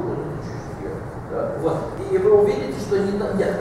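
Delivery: ordinary talk, nothing marked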